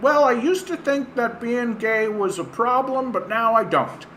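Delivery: high, put-on voice; mock mild recanting. Imagining a pundit owning an old position, in a high mock voice. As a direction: pitch it up like a harmless confession.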